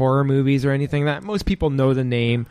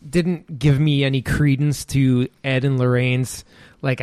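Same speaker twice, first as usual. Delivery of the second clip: ordinary talk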